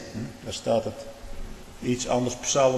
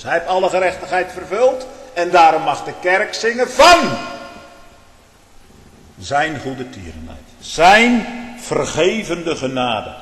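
impassioned Dutch preaching at full volume; full-force preaching; 7 dB louder than usual. Acted. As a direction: preach it out, lifting the congregation.

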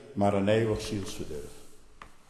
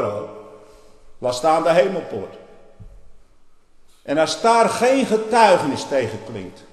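loud warning sermon; 6 semitones higher, 10 dB louder than usual. Real, not acted. Preacher warning of the narrow gate.

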